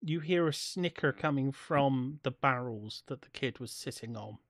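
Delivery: normal talking voice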